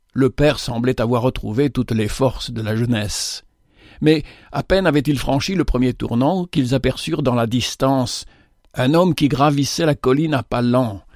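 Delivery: usual speaking voice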